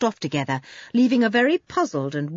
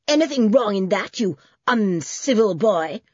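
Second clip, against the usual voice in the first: shouting; 3 semitones higher, 4 dB louder than usual. A narrator's reading. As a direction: snap it back at the boy, offended. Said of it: "shouted Mister Gringle".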